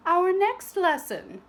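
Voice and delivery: a grand welcome to the ladies; prim teacher. Acted. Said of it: "said Madame Gertrude".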